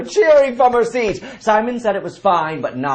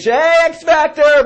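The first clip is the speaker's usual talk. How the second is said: higher, louder, slow mic yell; mock yell; 8 semitones higher, 5 dB louder, slower than usual. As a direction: shout it into a mic like a diva. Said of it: "yell into the microphone".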